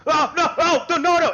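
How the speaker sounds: high, loud panicked "oh no" cries. Acted. Trappers backing away from the bear in panic. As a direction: yelp it out as they scramble back.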